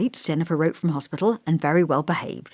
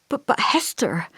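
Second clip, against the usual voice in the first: tentative; 7 semitones higher than usual. A narrator's reading. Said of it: "I said tentatively".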